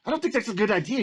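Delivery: high-pitched mock quote; Dan Druff. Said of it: "And Dan Druff's like".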